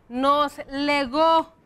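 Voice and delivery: "Nos legó" very slowly, weight on "gó"; stress on final ó. Shown as a demonstration. Teacher reviewing accented past verbs, "legó" stressed on the ó. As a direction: draw out the last syllable of "legó". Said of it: "que tenían que llevar acento".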